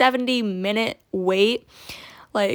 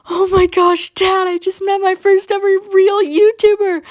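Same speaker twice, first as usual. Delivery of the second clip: much higher and louder, bursting with excitement; thrilled squeal; 10 semitones higher, 6 dB louder than usual. Retold or imitated. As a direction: squeal it like a starstruck kid. Girl thrilled to meet YouTuber.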